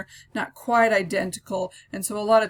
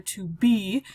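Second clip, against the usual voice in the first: "To" light, "be" louder and heavy; stress on BE; 4 dB louder than usual. Shown as a demonstration. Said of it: "we have the light, followed by a heavy stress".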